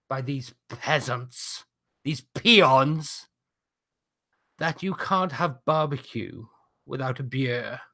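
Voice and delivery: slow, disdainful in-character wrestler taunt; put-on accent, drawn-out phrases; haughty heel. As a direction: look down on the crowd, word by word.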